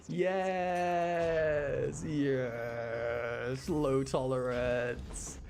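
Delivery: loud, drawn-out yes in celebration; triumphant cheer. Real, not acted. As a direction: cheer the lucky result, stretching the words. Gets the hoped-for low tolerance.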